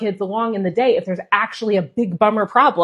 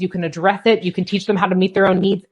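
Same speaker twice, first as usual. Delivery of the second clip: ordinary talk, nothing marked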